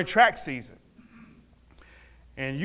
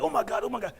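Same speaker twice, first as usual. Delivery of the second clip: high, hurried mock fretting; panicked coach; 7 semitones higher, 4 dB quieter, much faster than usual. Coach rushing the injured boy for help, imitated panic.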